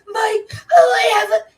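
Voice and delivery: high, loud mock-crying whine; mocking whiny voice. Retelling how the troll mocked her, in a mock wail. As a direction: imitate his mocking wail. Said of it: "he was mocking me, going".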